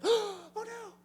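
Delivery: high squeal of alarm; mock gasp. Piglets crying out at their fallen brother, high and alarmed. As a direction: piglets panicking, voiced high. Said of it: "all the other piglets were like".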